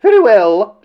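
high, loud retort; huffy assent. A narrator's reading. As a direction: give in with indignation. Huffy answer to the princess.

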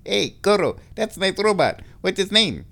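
high, badly dubbed child voice; mock a grown-up dubbing a little kid; high-pitched fake kid, punchy and comic; adult faking a kid. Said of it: "an adult trying to sound like a kid".

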